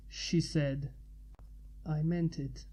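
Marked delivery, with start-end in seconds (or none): 1.9-2.7 soft, low-key reassurance; said quietly. Acted. Man reassuring her in bed, quietly. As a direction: keep it gentle and hushed. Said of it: "he said quietly".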